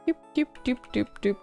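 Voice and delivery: ordinary delivery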